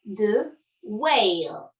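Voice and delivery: "whale" drawn out very slowly; teacher slows "whale"; slowed model word. Shown as a demonstration. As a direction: stretch the glide in "whale". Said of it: "Et maintenant".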